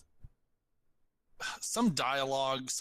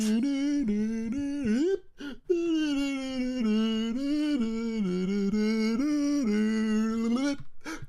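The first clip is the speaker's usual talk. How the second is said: high wheezing laugh fit; helpless squealing laughter; loud shrieking laugh; 9 semitones higher, 6 dB louder than usual. Real, not acted. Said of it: "Did you not hear me?".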